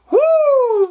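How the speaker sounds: high, loud woo; sarcastic whoop; mock cheer. Real, not acted.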